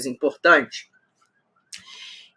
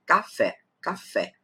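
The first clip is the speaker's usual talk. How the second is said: Café in two distinct syllables, fé stressed; syllable demonstration; 8 dB quieter than usual. Shown as a demonstration. Teacher counting two syllables, Café stressed on fé. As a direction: sound out Café, landing on fé. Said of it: "Duas sílabas".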